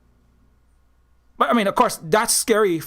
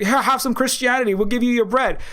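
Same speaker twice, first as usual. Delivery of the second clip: mock offering; 6 semitones higher than usual. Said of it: "they're trying to give you".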